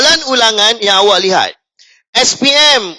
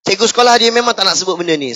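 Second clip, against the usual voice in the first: usual speaking voice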